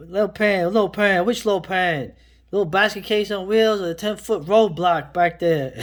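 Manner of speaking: high, loud mock outburst; loud retold movie line. Film fan re-enacting a favourite line from the movie, loud and worked up. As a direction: fire the quote out loud, imitating the film.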